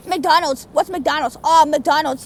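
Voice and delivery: like a cave woman rediscovering the modern world; amazed rediscovery. Acted. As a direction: puzzle at it, then marvel. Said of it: "it just felt like I came back".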